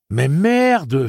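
high, loud, stunned dopey curse; dazed, silly voice. Acted. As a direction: blurt it out like a stunned fool. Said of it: "la voix aussi niaise que l'expression".

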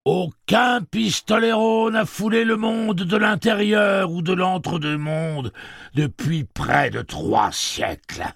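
slow, loud, very high booming voice; a huge voice echoing from above; booming machine voice. Acted. Blaine's voice from the speakers.

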